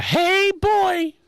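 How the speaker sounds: very high hoarse Mickey Mouse voice; Little Bit. Retold or imitated. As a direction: squeak it out like Mickey Mouse after a bender. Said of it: "because she, that's her voice".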